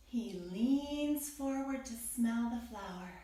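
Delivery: plain reading voice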